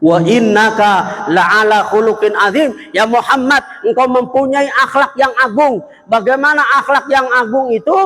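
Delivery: fervent sermon delivery; preaching. Acted. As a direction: lift the voice to preach. Preaching verse.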